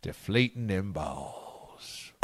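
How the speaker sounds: low, slow, hushed Southern drawl; drawn-out low Southern voice, close to the mic; southern drawl pillow talk. Pretend. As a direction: purr it like a sultry Southern pillow talk host. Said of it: "Southern draws are fun".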